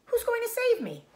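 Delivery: very high, loud eager question; eager cry. Acted. Wilbur asking Charlotte, high and loud. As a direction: beg for an answer. Pig pleads hopefully.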